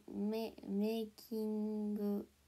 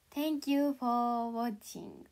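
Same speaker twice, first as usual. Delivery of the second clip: each word spelled out slowly, a bit louder; slow careful English; 4 dB louder, much slower than usual. Shown as a demonstration. Remembering the phrase, recites it slowly to chat. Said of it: "あ、そうだ。".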